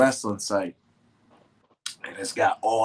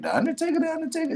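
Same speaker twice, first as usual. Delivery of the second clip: high falsetto echo of disbelief; disbelieving question; much faster than usual. Retold or imitated. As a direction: squeak the name in mock disbelief. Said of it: "And I was like".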